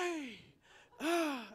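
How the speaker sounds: high, loud squeals of joy; delighted squeal. Retold or imitated. Wife hearing the news, squealing with joy. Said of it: "apparently she went".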